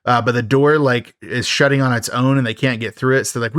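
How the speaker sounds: ordinary delivery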